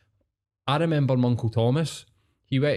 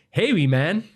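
high, loud, swooping transatlantic Scottish twang; big showy North American take on Scottish; Canadian-Scottish hybrid accent; 6 semitones higher, 5 dB louder than usual. Retold or imitated. Uncle back from Canada hailing his nephew, loud exaggerated Canadian-Scottish accent. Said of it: "John Barrowman fucking style".

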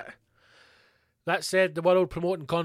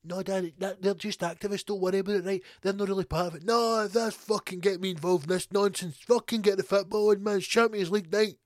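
a lightly voiced grumpy Scottish dad; dad waving off activists on the telly, mild gruff grumble; 4 semitones higher than usual. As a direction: grumble like a fed-up father wanting the football. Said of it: "And he's like".

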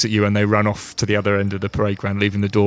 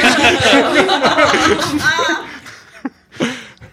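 high, louder, laughing order; laughing penalty; 5 dB louder than usual. Real, not acted. Game master punishing a player's cheek, laughing hard. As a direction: hand down the penalty through laughter.

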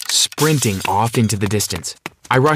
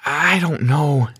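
slow, higher-pitched uncertainty; hesitant; 5 semitones higher, slower than usual. Acted. Sarah doubts the relationship, hesitant. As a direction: draw it out, unsure what to answer. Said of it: "said Sarah in hesitation".

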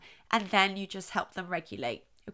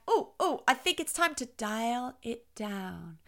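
exaggerated dialing down; slower than usual. Shown as a demonstration. Parent dialing down an excited child, exaggeratedly slow. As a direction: wind down slowly, drawing out the last words. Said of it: "I am exaggerating it here".

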